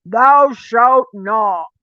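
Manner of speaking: mock stern commandment. Mocking stern commandment reading.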